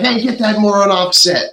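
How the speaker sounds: retold command, voice jumping up; mock urgent order. Crew ordering intruder off set.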